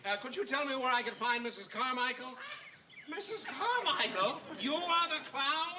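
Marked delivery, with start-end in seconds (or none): 3.1-5.8 shocked prim lady. Acted.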